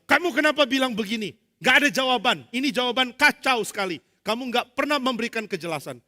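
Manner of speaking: loud, high harsh grilling of a student; attacking examiner. Acted. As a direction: tear into the thesis like an angry examiner. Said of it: "Dosen menyerang". (dosen penguji menyerang mahasiswa dengan suara keras dan marah)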